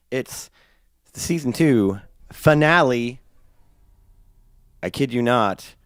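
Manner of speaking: ordinary talk, nothing marked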